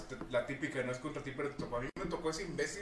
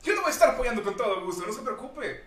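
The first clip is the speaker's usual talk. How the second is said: high, sweet overly polite agent voice; customer service tone; 7 semitones higher, 7 dB louder than usual. Retold or imitated. Agent soothing an angry caller, overly polite. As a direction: put on the helpful phone-agent voice. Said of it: "yo hablo con ese tono".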